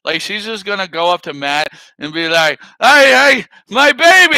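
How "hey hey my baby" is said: high, loud pestering call; mock demanding mother. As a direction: imitate a mother badgering the father. Imitating mother confronting Matt.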